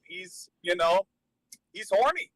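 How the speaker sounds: ordinary talk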